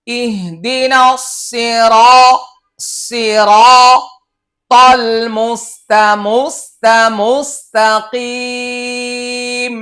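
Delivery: slow chanted verse recitation; Quran recitation. Acted.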